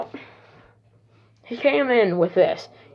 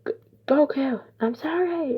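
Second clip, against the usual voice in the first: terrified; 6 semitones higher than usual. Retold or imitated. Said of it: "and he's terrified".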